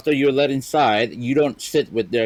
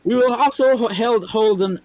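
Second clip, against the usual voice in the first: MC announcer voice; 11 semitones higher than usual. Acted. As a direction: keep booming like the host. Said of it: "I will read it as the M C".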